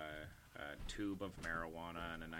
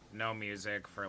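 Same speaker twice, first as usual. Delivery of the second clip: ordinary talk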